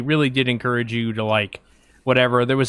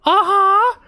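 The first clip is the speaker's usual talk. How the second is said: put-on much higher, louder squeaky kid's "uh-huh"; squeaky girl voice; 5 dB louder than usual. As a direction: mimic a young girl's squeak. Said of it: "she had, like, a squeaky voice".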